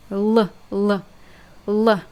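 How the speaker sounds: L said as a dark Russian L; Russian L sound demo. Shown as a demonstration. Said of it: "That's the sound it makes".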